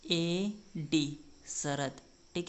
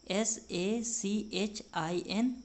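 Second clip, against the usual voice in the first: letters drawn out one by one; slow spelling; much slower than usual. Shown as a demonstration. Teacher recaps the spelling of Sachin slowly. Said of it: "ठीक है".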